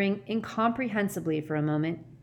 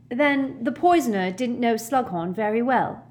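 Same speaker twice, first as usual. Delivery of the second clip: higher, louder voice as if stuffed up with a cold; bad head cold; 6 semitones higher, 5 dB louder than usual. A narrator's reading. Hermione speaks up for the first time in hours, bad head cold. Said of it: "sounding as though she had a bad head cold".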